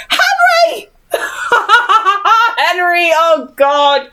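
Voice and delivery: very high, laughing cry of a name; shrieking laughter. Real, not acted. Reader seeing Henry arrive, shrieking with laughter. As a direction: burst out laughing mid-name.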